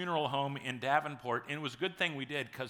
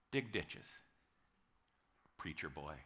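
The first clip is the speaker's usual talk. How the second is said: a low, soft, weighty voice of God, quieter; God's low reply; 11 semitones lower, 9 dB quieter than usual. Pretend. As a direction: answer like God, lowered and calm. God answers the angry pastor.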